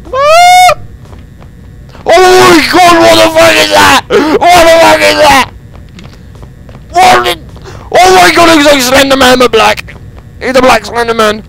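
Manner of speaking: high shrieking panic; panicked screaming. Real, not acted. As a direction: yell it in terror. Monster chase.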